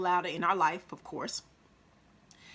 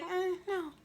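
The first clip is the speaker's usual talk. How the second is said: high, soft, sweet little refusal; tiny high-pitched nice no; cute polite no; 10 semitones higher, 6 dB quieter than usual. Shown as a demonstration. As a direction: turn them down cutely, small and high. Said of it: "but in a cute way".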